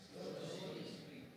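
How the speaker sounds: very slow, whispered "Slow to speak". Shown as a demonstration. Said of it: "Say slow to speak slowly".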